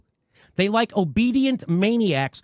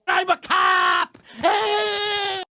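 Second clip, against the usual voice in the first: shouting, whiny cop caricature with a higher voice; mock angry cop; 8 semitones higher, much slower than usual. Host mocking a cop who demands respect, shouted caricature.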